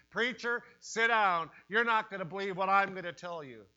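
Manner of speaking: emphatic acted confider. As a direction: order the preacher to listen, loudly. Voices people confessing to preacher.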